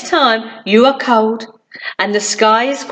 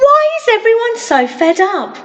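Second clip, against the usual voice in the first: puzzled; 10 semitones higher than usual. Acted. Sunshine puzzled at grumpy town.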